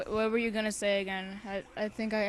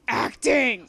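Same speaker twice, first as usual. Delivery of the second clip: yelled; 3 semitones higher, 12 dB louder than usual. Acted. Character yelling in story.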